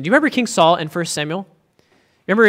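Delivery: ordinary delivery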